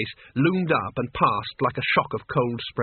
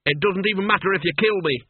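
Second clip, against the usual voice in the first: higher, rushing panic; babbling; 6 semitones higher than usual. A narrator's reading. Wizard to the Duke, babbling in fear. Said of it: "Cutwell babbled".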